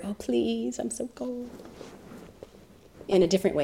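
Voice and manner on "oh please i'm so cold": pleading snake. Acted. Snake quoted again.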